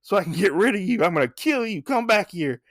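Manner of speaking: high, fast, mock furious threat; raging threat. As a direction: chase the thief with a cartoon rage. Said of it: "He's like".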